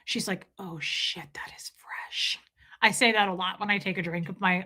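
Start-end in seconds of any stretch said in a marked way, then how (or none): 0.6-2.4 hushed, slow whisper; whispered quote. Retold or imitated. Quoting the lemonade scene to viewers, whispered. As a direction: whisper it, savoring the taste.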